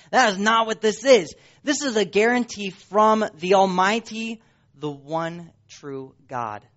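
normal talking voice

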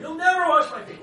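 very high, loud, stress on never; Peter's outcry. Acted. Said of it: "Speak first, think later".

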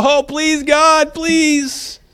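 high, drawn-out pleading; imitating someone begging; mock begging. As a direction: plead in a climbing, hammed-up whine. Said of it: "We don't beg and go".

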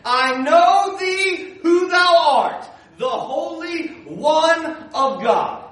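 loud, high, slow proclamation as the devil; devil crying out. Acted. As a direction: cry it out word by drawn-out word. Devil cries out to Jesus.

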